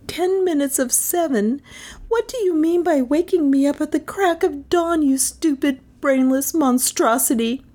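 high-pitched outraged tirade; indignant scolding. Acted. Melissa scolding Carstairs for waking her at dawn, indignant. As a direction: rail at him in a high, aggrieved voice.

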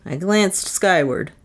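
usual speaking voice

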